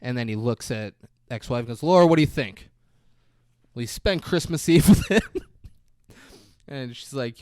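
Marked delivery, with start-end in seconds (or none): 1.8-5.4 louder, higher, breaking into laughter; laughing mock plea. Retelling his question to the ex-wife, laughing. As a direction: ask it while starting to crack up.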